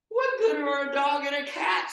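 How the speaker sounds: high, loud, put-on scoffing voice; indignant Peter. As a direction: make Peter sound outraged by the advice.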